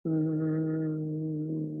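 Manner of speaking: long, level hum on the exhale; audible vibrating hum. Shown as a demonstration. Teacher showing bee breath on the exhale, steady hum. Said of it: "audible vibration".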